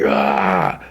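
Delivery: strained mock cry of rage; mock attack roar. Keith tackling through the wall, strained mock roar. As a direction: imitate an attacker's yell without full volume.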